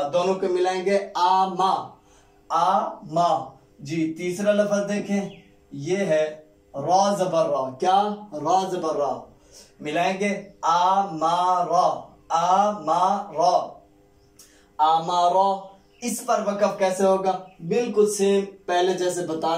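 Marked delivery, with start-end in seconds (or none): 1.2-3.6 very slow, drawn-out blend. Shown as a demonstration. Teacher modelling the joined word, very slowly. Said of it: "teacher blends syllables slowly". 10.6-15.7 very slow, drawn-out blend. Shown as a demonstration. Teacher modelling the joined word, very slowly. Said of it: "teacher blends syllables slowly".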